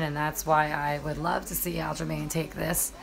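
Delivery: usual speaking voice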